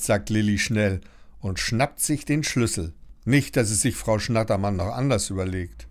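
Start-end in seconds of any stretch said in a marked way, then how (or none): none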